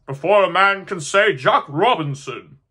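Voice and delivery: loud, brisk jolly order; hearty command. Acted. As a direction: clap and call it out. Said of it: "with a sharp clap of his hands".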